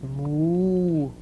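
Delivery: long, drawn-out moos; imitated cattle lowing. A narrator's reading. Cattle stuck in the road, long lowing moos. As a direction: stretch each moo out like a cow. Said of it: "went the cattle".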